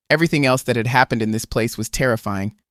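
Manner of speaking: usual speaking voice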